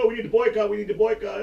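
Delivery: high, loud whiny outrage; mock irate fan. Mimicking angry fans of a losing team, loud and whiny. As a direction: ham up a fan crying boycott. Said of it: "get all irate and go".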